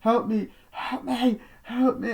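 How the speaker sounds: high-pitched acted pleading; imitating a trapped horror heroine; desperate cry for help. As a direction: call out in panic, very high. Acting screaming girl calling for help.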